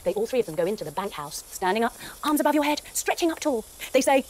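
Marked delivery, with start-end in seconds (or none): none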